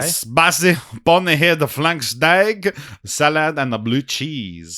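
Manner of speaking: lightly done French waiter; mild French waiter accent; thick French accent. Acted. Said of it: "The waiter has a very thick French accent".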